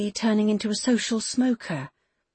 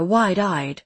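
ordinary delivery, nothing marked

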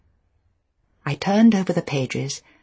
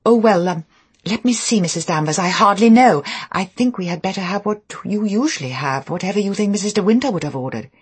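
higher, louder, hesitant then hurried; flustered dithering; 5 semitones higher, 5 dB louder than usual. A narrator's reading. New wife unable to choose a sauce for housekeeper, flustered. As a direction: dither nervously and hand the choice back.

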